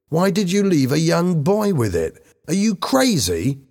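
high-pitched, indignant questioning; angry. A narrator's reading. Bobby rebukes Helen for leaving the money, angry. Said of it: "said Bobby angrily".

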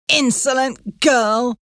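slow, sneering lady of the house; scornful mistress. A narrator's reading. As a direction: spit it out with contempt. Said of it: "said Missus Pancroft".

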